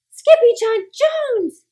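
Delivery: slow, high, loud shout of a name; crying out. Acted. Everyone shouts at Skippy bursting out of the closet. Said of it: "everyone cried".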